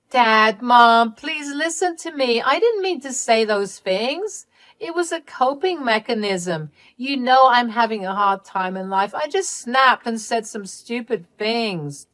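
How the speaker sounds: high, wheedling excuses after a loud opening; pleading Candy. Acted. Daughter excusing herself to her parents, high pleading. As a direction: beg to be heard, voice lifted.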